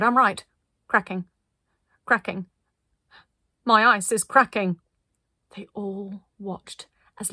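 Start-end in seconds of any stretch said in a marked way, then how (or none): 0.0-4.8 loud, measured alarm; cried out. A narrator's reading. Linda realising her ice is cracking, crying out. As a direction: announce the danger loudly, with pauses. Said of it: "she cried".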